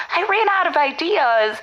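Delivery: usual speaking voice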